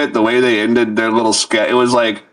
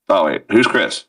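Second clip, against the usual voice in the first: ordinary delivery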